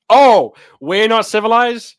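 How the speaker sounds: high, loud, drawn-out mock outrage; mock indignation. Streamer answering a viewer's jibe about Kiwis, mock indignant. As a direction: blurt it out in hammed-up offence. Feigned offence at a viewer's comment.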